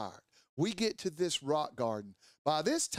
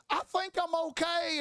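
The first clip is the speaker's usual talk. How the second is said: much higher-pitched imitated voice; high voice of a fallen friend calling up; injured rider's reply; 13 semitones higher than usual.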